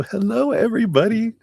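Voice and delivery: high, bright hello to everyone; excited greeting. Real, not acted. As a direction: sing out the hello.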